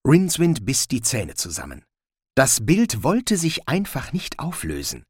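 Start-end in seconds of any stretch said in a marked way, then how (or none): none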